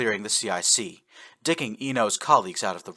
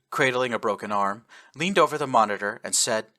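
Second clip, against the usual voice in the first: ordinary delivery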